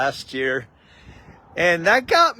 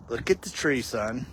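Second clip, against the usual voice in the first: much softer and lower, gentle fatherly hush; kind and loving; 7 semitones lower, 8 dB quieter than usual. Retold or imitated. Father gently steering his crying son to the tree, hushed and loving. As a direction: coax him tenderly toward the tree. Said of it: "So my dad lovingly and kindly".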